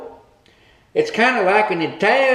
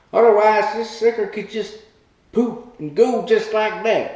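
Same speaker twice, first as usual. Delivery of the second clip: ordinary talk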